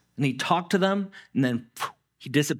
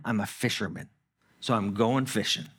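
defeated resignation; 5 semitones lower, 4 dB quieter than usual. Retold or imitated. Peter telling himself he's only a fisherman, resigned. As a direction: lower and softer, resigned. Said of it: "he's like".

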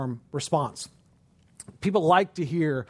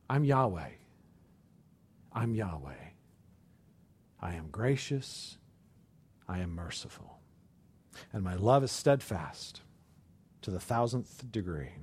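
hushed, much softer and lower, reassuring; tender God voice; 8 semitones lower, 7 dB quieter than usual. Acted. God reassuring a fearful Moses, hushed and tender. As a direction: speak it like a loving whisper of mercy.

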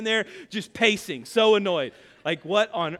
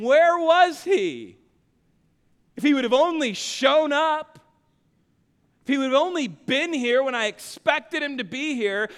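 loud, raised voice of reproach; indignant complaint; 4 semitones higher, 4 dB louder than usual. Acted. Crowd complains that he never showed up, indignant. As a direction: call it out accusingly, easing slightly as it goes.

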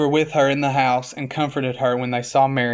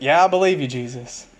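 mock profession of faith. Preacher mimicking complainers who still claim belief, mock-eager. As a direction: burst out over-keen, then let the name sink.

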